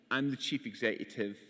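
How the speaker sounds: usual speaking voice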